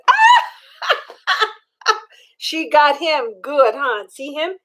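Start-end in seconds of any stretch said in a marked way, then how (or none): 0.1-1.9 high, laughing shout; mock shout. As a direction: shriek it like a playful yell. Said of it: "She says".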